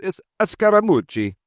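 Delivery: Scaramucci said in a playful Italian accent, high and sung; Italian accent on Scaramucci, bouncy and high. Shown as a demonstration. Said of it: "if you really want to throw in the Italian accent".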